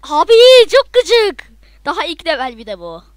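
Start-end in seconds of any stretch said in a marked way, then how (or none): 0.0-1.5 loud, slow, high cry of frustration; frustrated yell. Real, not acted. Gamer howling at an impossible level, loud and slow. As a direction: drag it out in a loud howl.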